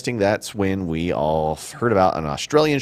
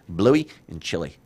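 slower, lightly put-on Australian accent. Shown as a demonstration. Host savouring the dogs' names, light Australian accent. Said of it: "kinda do it with an Australian accent".

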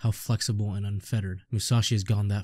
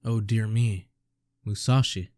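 ordinary talk, nothing marked

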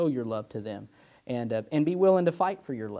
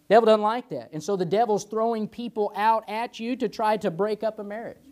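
full-force preaching; 7 semitones higher, 3 dB louder than usual. Acted. Preaching climax on the devil.

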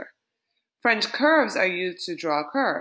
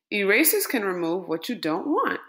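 ordinary delivery

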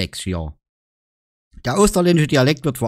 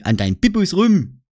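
ordinary delivery

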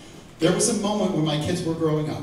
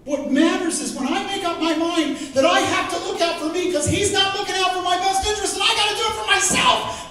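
higher, louder, pressing preaching; building intensity; 11 semitones higher, 4 dB louder than usual. Acted. Preacher voicing selfish self-reliance, rising and louder. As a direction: drive it up with urgency.

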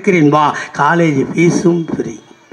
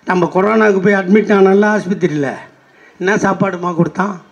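usual speaking voice

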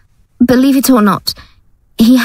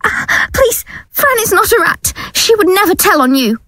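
scared pleading; 8 semitones higher than usual. Acted. Gail defends Fran, terrified.

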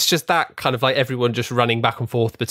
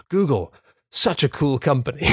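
higher mock-posh gush over Google; posh older man; 4 semitones higher than usual. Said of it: "this, like, posh man in his fifties".

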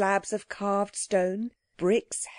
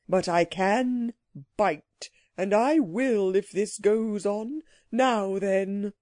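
slow, threatening creature voice; very angry; slower than usual. A narrator's reading. Psammead threatening the wishers, slowly and angrily. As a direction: warn them with deliberate, contained anger. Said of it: "and spoke very angrily".

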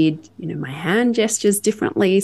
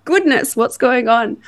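high, loud flustered gasp of alarm; panicked surprise; 8 semitones higher, 5 dB louder than usual. Retold or imitated. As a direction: blurt it out in sudden panic. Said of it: "I'm like".

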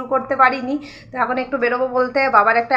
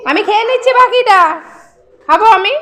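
playful teasing; 9 semitones higher, 6 dB louder than usual. Real, not acted. Teasing child about the drink.

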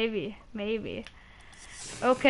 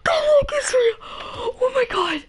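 ecstatic screaming; 10 semitones higher than usual. Real, not acted. Opening another crate for his friend, ecstatic screaming. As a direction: scream with joy, can't believe it. Second lucky unboxing.

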